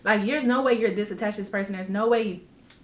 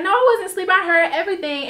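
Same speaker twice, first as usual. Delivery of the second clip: mimicking friend; 7 semitones higher, 4 dB louder, faster than usual. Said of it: "She was like".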